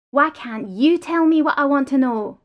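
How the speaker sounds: loud, slow, exasperated question; frustrated demand. Acted. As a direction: demand it, fed up. Prisoner demands answers.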